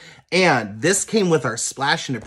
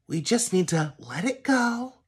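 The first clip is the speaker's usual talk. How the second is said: Elsa quote; 7 semitones higher, 6 dB quieter than usual. Retold or imitated. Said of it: "as Elsa would say".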